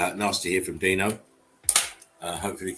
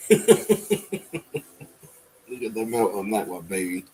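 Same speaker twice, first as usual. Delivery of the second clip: laughing; 4 dB louder than usual. Real, not acted. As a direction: repeat it through a real laugh. Repeats line while laughing.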